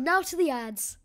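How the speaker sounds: normal talking voice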